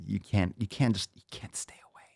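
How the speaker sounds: mock helplessness trailing into a whisper; fading to whisper. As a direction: let the voice drop away to a whisper.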